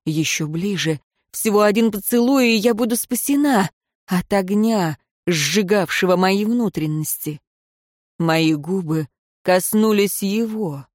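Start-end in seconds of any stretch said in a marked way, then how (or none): none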